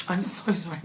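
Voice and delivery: ordinary talk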